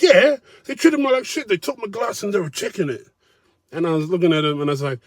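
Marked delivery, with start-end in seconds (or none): 0.0-3.1 much higher, self-righteous whine; higher indignant whine of the complaining guest; indignant mock whine. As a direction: play the outraged diner. Mocking the man's indignation.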